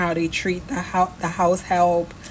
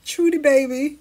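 normal talking voice

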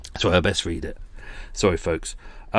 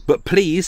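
"please" leaps much higher and louder; stress on please; 8 semitones higher, 3 dB louder than usual. Shown as a demonstration. Reading the grovelling email, begging "please" in capitals. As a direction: punch "please" like it's in capitals. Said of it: "in capitals again".

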